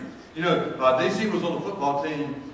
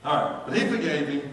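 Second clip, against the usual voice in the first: normal talking voice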